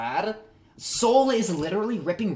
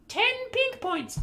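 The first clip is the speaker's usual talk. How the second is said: much higher, slow and sing-song; high silly voice; slower than usual. Shown as a demonstration. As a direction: squeak it out like a cartoon prize announcer. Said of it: "You get ten pink points".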